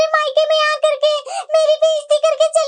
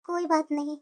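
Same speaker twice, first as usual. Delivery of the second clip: much lower, softer hesitant mother; worried mother voice; 12 semitones lower, 6 dB quieter than usual. Acted. Mother worried about costly laddoos, low hesitant voice. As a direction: fret about the expense.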